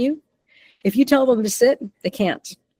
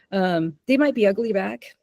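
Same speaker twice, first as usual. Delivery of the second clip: usual speaking voice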